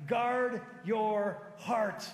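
"guard your heart" very slow and loud, each word weighted; emphatic. Real, not acted. Preacher gives the message's key verse, "guard your heart" slow and emphatic. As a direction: land "guard", "your", "heart" as three separate loud beats.

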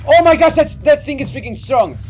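high, loud alarmed exclamation. Real, not acted. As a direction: gasp out at the danger. Strong enemy attacks.

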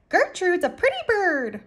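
high, squawking parrot voice; parrot scream. A narrator's reading. Parrot praising herself in the shop, high screech. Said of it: "screamed Gertrude".

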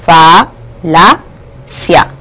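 "falacia" very slowly, syllable by syllable; silabeado. Shown as a demonstration. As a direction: break "falacia" into fa, la, cia with clear pauses. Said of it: "si nosotros silabeamos esta palabra, sería". ("falacia" sílaba por sílaba, muy lento)

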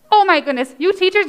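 ordinary talk

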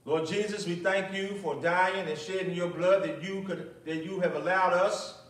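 ordinary talk, nothing marked

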